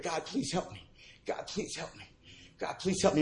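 soft, repeated plea; whisper-soft begging to God; quiet pleading prayer. Retold or imitated. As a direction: alone in the basement praying for help, softly.

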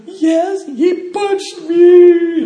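very high, loud, drawn-out tattling whine; boy telling on him; whiny hurt kid. Retold or imitated. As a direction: wail it like a punched kid. Crying victim voiced.

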